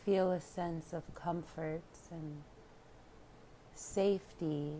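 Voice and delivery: hushed, spacious relaxation guidance; guided meditation. Real, not acted. Yoga teacher inviting listeners to surrender, calm meditation voice. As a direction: let each phrase settle into a long pause.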